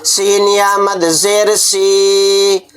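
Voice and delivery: सी drawn out long and slow, teacher's demo; stretched vowel. Shown as a demonstration. Teacher showing students how to stretch the madd, slow and drawn out. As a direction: stretch the vowel in सी for four counts, slowly. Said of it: "जिस तरह मैं खींच रहा हूँ".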